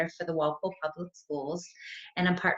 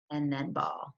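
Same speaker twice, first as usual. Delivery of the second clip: ordinary delivery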